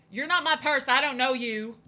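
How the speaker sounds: imitating a loud brush-off; retold loud rejection. Supposed friend disowning the speaker, loud retold rebuff. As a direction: push it out loud, disowning someone.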